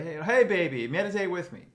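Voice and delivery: loud, high mock invitation to a partner; hammed-up call, swooping high and loud; playful calling out. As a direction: holler it jokingly to your sweetheart. Acted invitation called to partner.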